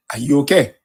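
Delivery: ordinary talk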